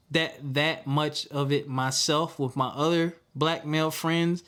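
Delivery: usual speaking voice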